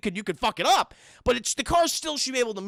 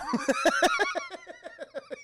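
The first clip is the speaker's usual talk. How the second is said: high-pitched laugh. Real, not acted. Laughing at the creepy scene.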